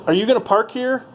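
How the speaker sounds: high, rising mock voice of the pushy woman; mimicking the parking lady. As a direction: squeak it up like the nosy attendant.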